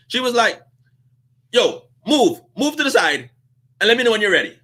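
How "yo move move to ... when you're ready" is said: higher, pushy step-aside order; brusque dismissal. Retold or imitated. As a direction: clear him off the counter fast. Cashier tells him to step aside.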